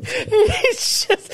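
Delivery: ordinary talk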